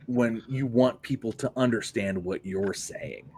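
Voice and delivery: ordinary talk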